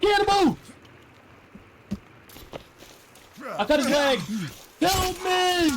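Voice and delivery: high shouting for help; panicked yelling. Real, not acted. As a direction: yell it out in a panic.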